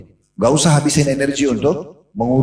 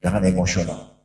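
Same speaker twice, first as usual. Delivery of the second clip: softer, low, calming voice; calm and soothing; 6 semitones lower, 6 dB quieter than usual. Retold or imitated. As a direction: hush him gently, steady and unhurried. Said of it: "Nabi dengan tenang".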